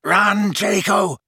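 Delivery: high, drawn-out shout; shouted order. A narrator's reading. Snape sending Draco fleeing, shouted. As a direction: call it out long and urgent. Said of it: "Snape shouted".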